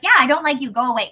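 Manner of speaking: retold brush-off. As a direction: send her off bluntly. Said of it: "I was like".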